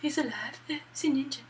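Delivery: soft, high, odd imitated woman; mock weirded-out reaction, softly; all weird. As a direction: act oddly affected, in a small high voice. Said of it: "Naomi gets all weird about it".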